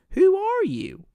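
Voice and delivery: high squeaky mock question; playful falsetto who are you; mock surprise. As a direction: pop the question up high as a joke.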